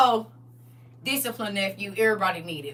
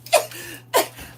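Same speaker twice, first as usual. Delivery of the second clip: sobbing laugh, high; half-laughing, half-sobbing; high crying laugh; 14 semitones higher than usual. Acted. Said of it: "you be like".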